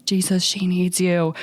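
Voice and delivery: mild retold yell, slow and drawn out; half-voiced cry out to Jesus; mock yell. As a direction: imitate a desperate yell without real volume. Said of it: "he just starts yelling in".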